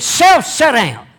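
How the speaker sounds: high, loud order to himself; stern command. Acted. Nehemiah ordering his own self to sit, loud and stern. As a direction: bark it at yourself like a drill sergeant.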